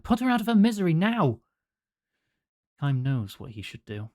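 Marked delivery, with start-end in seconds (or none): 0.0-1.4 high, loud and fast; doctor shouting at the caregiver; angry order. Acted. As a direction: bark the order. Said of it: "he shouts at Kym".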